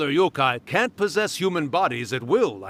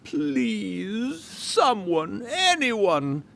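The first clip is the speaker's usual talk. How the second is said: imitating plea; 7 semitones higher, slower than usual. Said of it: "heard someone yelling".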